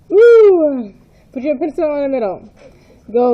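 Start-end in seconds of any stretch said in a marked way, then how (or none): 0.1-0.9 high, loud whoop; excited cheer. Real, not acted. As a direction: cheer it out big. Cheer after rewinding back.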